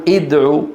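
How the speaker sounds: ادعو started with i, "id'u"; wrong opening vowel. Shown as a demonstration. Teacher showing what we do not say, with kasra. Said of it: "ولا نقول".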